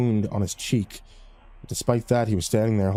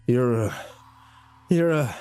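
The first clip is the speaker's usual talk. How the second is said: rasping while choked; slower than usual. Acted. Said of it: "Colt rasped out".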